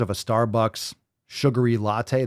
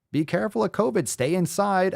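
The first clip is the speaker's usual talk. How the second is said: high, mocking voice of caution; high-pitched nagging advice; 9 semitones higher than usual. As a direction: parrot the warnings in a raised pitch. Mocks official covid advice.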